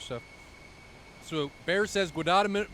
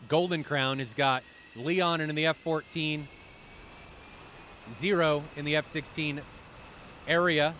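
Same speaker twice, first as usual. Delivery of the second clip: normal talking voice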